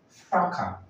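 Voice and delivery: fracas said carefully as a word alone; model pronunciation. Shown as a demonstration. Teacher modelling a commonly mispronounced word, careful fracas. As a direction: say "fracas" on its own for learners to copy. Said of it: "words you're probably mispronouncing".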